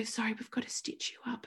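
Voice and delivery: hushed, whispery retold apology; very soft and apologetic; apologetic doctor. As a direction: imitate a doctor gingerly breaking bad news. Doctor breaks news, acted softly.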